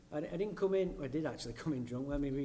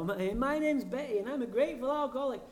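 high, quick, chirpy woman's voice; mock cheerful share voice; giddy woman; 7 semitones higher than usual. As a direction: bubble it out like a giddy newcomer. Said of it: "some giddy woman would be".

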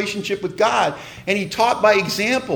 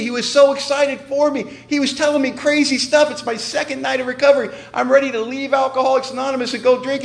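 ordinary delivery

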